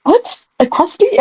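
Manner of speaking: high, startled disbelief; baffled what. Real, not acted. Reader stunned by her own typo, high baffled squeak. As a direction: squeak it out like you can't believe the text. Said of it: "Am I reading right?".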